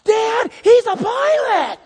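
put-on high, loud, drawn-out kid shout; child's yell. Boy telling his dad across the aisle, excited yell. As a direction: imitate an excited boy hollering. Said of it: "the little guy yells across the aisle".

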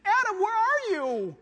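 very high, loud calling cry. Acted. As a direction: cry out across the garden. God calling out again, higher.

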